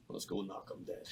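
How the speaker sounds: soft, unvoiced psych-up; breathy hush to himself before walking out; muttered pep talk. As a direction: say it under your breath to yourself. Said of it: "Then I light up the smoke".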